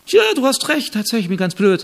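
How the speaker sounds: lightly hammed-up humble servant; mock meek agreement. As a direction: agree brightly with the insult. Humble man meekly agrees with insult.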